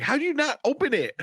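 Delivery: high-pitched mock-incredulous cry. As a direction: exclaim in exasperated disbelief, pitch way up. Voices own incredulous reaction while watching.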